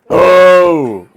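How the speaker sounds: sudden high, loud yell; startled loud shout. Real, not acted. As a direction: blast out a surprised shout. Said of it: "that was loud".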